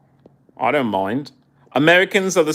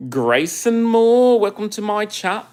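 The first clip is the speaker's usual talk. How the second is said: excited greeting; 8 semitones higher than usual. Real, not acted. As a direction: stretch the name out brightly. Greeting a viewer by name.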